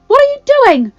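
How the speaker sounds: very high, loud, appalled question; cried in shock. A narrator's reading. Belle challenges Giles over his sword, shocked. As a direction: protest at the top of the voice. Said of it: "Belle cried in shock".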